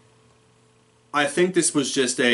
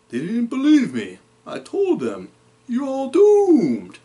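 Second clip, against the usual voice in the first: high, eerie doom-warning voice; Crazy Ralph voice; 13 semitones higher, 3 dB louder, slower than usual. Retold or imitated. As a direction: croon a creepy prophecy of doom, stretching the end. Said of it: "you know, Crazy Ralph impression".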